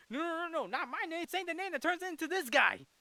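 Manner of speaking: squeaky high panicked protest; high silly voice. Retold or imitated. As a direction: pitch way up, flustered and whiny. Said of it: "but Shazam was like".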